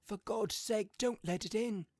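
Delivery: high, loud put-on plea; fearful old man's cry. A narrator's reading. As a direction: beg in a hammed-up frightened voice. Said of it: "cried the old man, shaking with fear".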